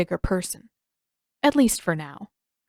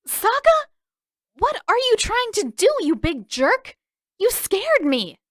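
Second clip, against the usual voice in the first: put-on much higher, louder voice of a startled girl; a young girl who got a fright and now scolds; startled angry girl; 13 semitones higher, 4 dB louder than usual. A narrator's reading. Startled sister scolds her brother.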